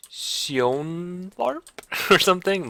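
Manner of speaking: hammed-up Swedish melody on "Sionvarp", slow and high; sing-song Swedish cadence. Shown as a demonstration. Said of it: "the different cadence and the different ... up and down sounds of it".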